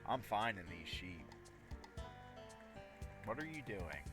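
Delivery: usual speaking voice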